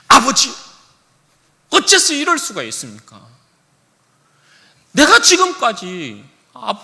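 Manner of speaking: loud, high, indignant protest to his father; aggrieved son shouting at his father; elder son's furious outburst. Acted. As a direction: pour out years of resentment, shouting. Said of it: "자신의 울분을 아버지에게 막 토하기 시작합니다".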